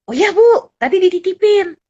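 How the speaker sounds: lightly voiced guard, keen to help; security guard. Guard explains wallet was handed. (satpam menjawab ibu dengan semangat)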